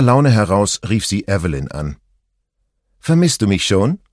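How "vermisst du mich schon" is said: lightly teasing question, lifted in pitch; joking. A narrator's reading. Friend answering the phone with a joke, lightly teasing. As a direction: tease a friend playfully. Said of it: "scherzte ihre Freundin".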